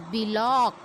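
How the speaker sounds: "बिलॉक" split as bi-lock; wrong pronunciation. Shown as a demonstration. Teacher showing how learners err, two-syllable "बिलॉक". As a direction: add an i after b in "बिलॉक". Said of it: "this is wrong pronunciation".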